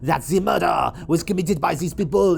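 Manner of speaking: hammed-up Belgian-French accent; Poirot. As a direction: do a comic French-sounding Belgian accent, rising at the end. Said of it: "trying to do the accent".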